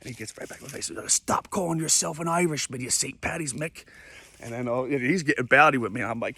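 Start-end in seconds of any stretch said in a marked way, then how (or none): 1.1-3.8 lightly put-on angry British accent; scolding Brit. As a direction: tell him off as a mock British tough guy. Angry Brit scolds him, acted.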